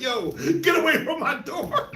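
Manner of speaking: quoting a neighbor's brush-off through laughter; laughing mock warning. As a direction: drag it out while cracking up. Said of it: "He was like".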